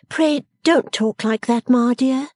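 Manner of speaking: high, slow daughterly plea; protesting Bella. A narrator's reading. Bella objecting to Ma's reproach, earnestly high.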